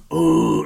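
loud imitated grunt of excitement; mock guttural outburst. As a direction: grunt it out hard from the throat. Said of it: "she made a guttural grunt".

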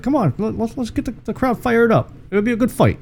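lightly voiced French-accented goalie; imitating Flower. Cohost imitates goalie on the mic'd-up clip, soft and high. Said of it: "when he's like".

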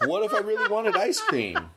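high-pitched question through laughter; laughing. Real, not acted. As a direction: barely get the question out for laughing.

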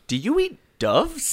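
high, disbelieving question; shocked at a friend's diet; incredulous. Real, not acted. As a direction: asks the friend in high disbelief if he eats doves. Shocked at dove eating.

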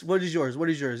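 ordinary talk, nothing marked

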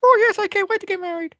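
very high, put-on dopey bride voice; mock drugged bride. Cohost mocks Stephanie's drugged wedding in a very high dopey bride voice. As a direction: squeal it like a dazed, dreamy bride.

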